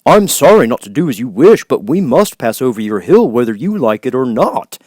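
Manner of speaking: polite but bold; bold Scarecrow. Acted.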